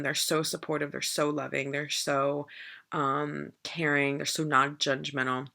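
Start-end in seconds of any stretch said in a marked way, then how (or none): none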